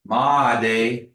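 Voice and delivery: Ma De, said slow and loud; staccato. Shown as a demonstration. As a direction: say Ma De as two separate syllables. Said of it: "one word at a time".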